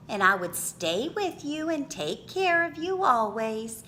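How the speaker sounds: ordinary delivery, nothing marked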